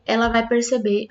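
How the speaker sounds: usual speaking voice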